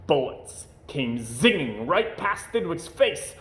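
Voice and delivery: ordinary delivery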